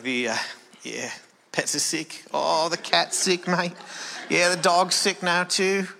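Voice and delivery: imitated Australian father-in-law; Australian accent. Father-in-law reports sick pets, imitated Australian accent. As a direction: put on a drawn-out Australian accent. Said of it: "My wife is Australian".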